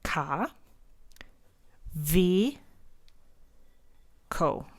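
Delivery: "ka, ve, ko" split slowly into syllables. Shown as a demonstration. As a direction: spell it out in sounds. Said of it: "what we do is we would say".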